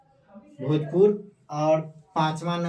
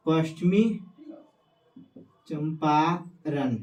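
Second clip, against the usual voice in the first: slow drawn-out naming; slower than usual. Shown as a demonstration. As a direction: stretch Champaran out slowly. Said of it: "मिल जाएगा पश्चिमी चंपारण".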